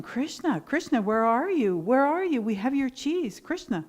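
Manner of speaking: calling out. Retold or imitated. Milkmaids searching for Krishna, calling out loudly. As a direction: call across for someone out of sight. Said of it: "they were yelling".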